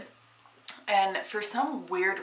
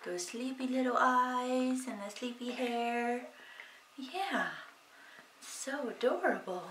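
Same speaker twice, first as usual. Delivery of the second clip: murmuring fondly to her newborn as he wakes; soft cooing; 4 semitones higher, 10 dB quieter than usual. Real, not acted. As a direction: coo tenderly at the little one. Mother cooing over waking baby.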